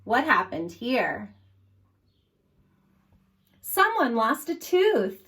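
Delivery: ordinary talk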